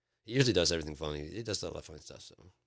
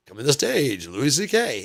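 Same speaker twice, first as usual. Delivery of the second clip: loud, raised-pitch club introduction; stage announcer; 8 semitones higher, 11 dB louder than usual. Acted. Host bringing a comedian to the stage, announcer shout. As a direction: announce the act to the whole room.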